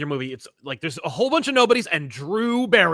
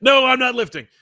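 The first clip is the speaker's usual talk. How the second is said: mock refusal; 8 semitones higher, 8 dB louder than usual. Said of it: "Everyone's like".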